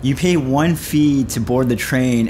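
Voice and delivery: normal talking voice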